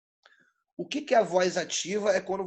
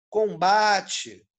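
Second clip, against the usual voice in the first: Combate with a long, loud BA; stress on middle syllable; 4 dB louder than usual. Shown as a demonstration. Teacher showing a non-nasal tonic syllable, stretched BA in Combate. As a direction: hold the BA in Combate. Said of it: "Ba-, não tem, ó".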